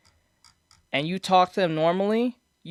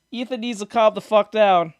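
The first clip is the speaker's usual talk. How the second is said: ordinary talk, nothing marked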